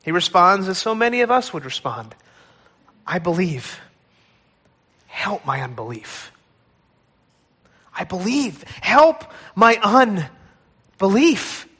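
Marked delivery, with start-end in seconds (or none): 3.1-6.3 low, soft, broken cry; exasperated cry. Acted. Father confessing his doubt to Jesus, low broken cry. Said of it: "with an exasperated cry". 7.9-11.7 exasperated cry rising. Acted. Father pleading with Jesus, slow swelling cry. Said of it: "with an exasperated cry".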